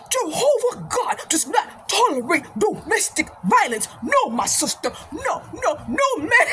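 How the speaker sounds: indignant imitated African accent, pitched high; mock African-accented sermon; African brother's accent. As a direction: preach it like an African brother, each word weighty. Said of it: "this brother from Africa".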